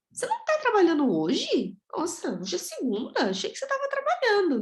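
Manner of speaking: high-pitched incredulous questioning; acted surprise. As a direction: ask as if caught off guard, voice swooping high. Acts surprised rhetorical question to sister. (surpresa encenada, voz bem aguda)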